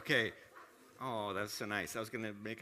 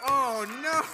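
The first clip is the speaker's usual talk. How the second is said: alarmed; 12 semitones higher, 10 dB louder than usual. Real, not acted. Trick goes wrong.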